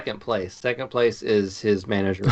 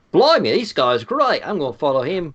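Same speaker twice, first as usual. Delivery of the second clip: a hammed-up English voice, slightly higher; mock British accent; 5 semitones higher, 5 dB louder than usual. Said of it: "I think I went more Australian there".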